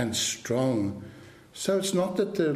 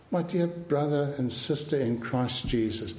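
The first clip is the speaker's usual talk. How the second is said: ordinary talk, nothing marked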